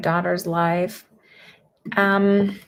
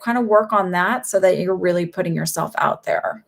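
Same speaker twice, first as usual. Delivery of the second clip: ordinary talk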